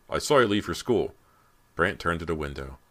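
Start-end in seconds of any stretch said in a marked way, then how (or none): 0.1-1.1 louder, higher stern questioning; a touch of parental sharpness; sharp demand. A narrator's reading. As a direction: demand an explanation. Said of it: "Mister McCloy demanded sharply".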